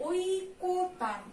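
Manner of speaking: ঐকতান drawn out very slowly as oikotan; ঐকতান read syllable by syllable for students; spelled-out pronunciation. Shown as a demonstration. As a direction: stretch ঐকতান slowly with an o after the k, oi-ko-tan. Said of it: "কয়ের সঙ্গে কিন্তু একটা ওকার যোগ হবে".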